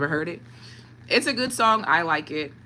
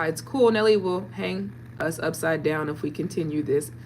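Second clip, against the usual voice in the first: ordinary talk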